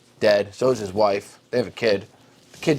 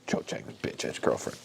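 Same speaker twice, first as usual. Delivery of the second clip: whispered aside; 10 dB quieter than usual. Acted. Snarky aside about Cho.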